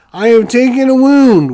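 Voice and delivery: loud dismayed groan of a line; frustrated outburst. Real, not acted. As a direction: blurt it out, annoyed. Bad roll, forced to take wound.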